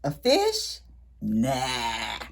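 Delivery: ordinary delivery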